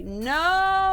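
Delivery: loud high cry of No!; dismayed shout. Real, not acted. Player seeing a failed roll, loud dismayed shout. Rolled a bad die.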